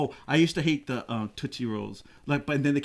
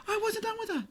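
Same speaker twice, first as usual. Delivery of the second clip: high mock whine; 7 dB quieter than usual. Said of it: "you're like".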